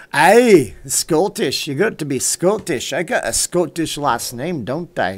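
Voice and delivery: hammed-up Scottish brogue with a big opening "Aye"; bad Scottish accent. Streamer claiming Scottish roots to viewers, in bad Scottish accent. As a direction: burst in loud, then ease off, in mock Scottish. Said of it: "used to do a decent Scottish accent".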